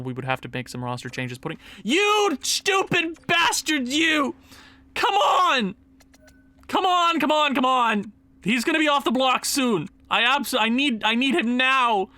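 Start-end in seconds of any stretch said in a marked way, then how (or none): 1.8-5.7 high, drawn-out cry of frustration; real exasperation, pitched way up; exasperated outburst. Real, not acted. Trade offer gets turned down. 6.7-12.1 high, urgent begging; worked-up, high-pitched insistence; desperate pleading. Real, not acted. Desperate for the player before he's gone.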